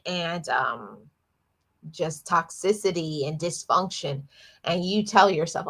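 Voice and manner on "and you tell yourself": ordinary delivery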